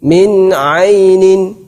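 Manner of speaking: Min ainin slow, nun clear; Quran recitation. Shown as a demonstration. Teacher giving separated example.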